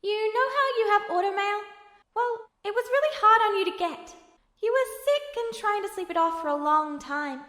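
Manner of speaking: remembering a promise, pushing on; earnest younger brother Al. Acted. Al tells his memory.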